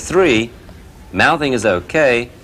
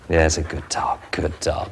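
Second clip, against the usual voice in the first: soft praise; 3 semitones lower, 5 dB quieter than usual. Real, not acted. Praising gentle puppy.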